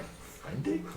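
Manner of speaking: very soft, voiceless question; hushed reaction. Acted. Palmyra privately questions being on a friend date, whispering. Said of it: "she was like".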